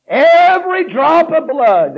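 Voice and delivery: slow and loud; fervent preaching. Acted. Preacher telling of Jesus' blood, slow and loud. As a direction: lean on each word.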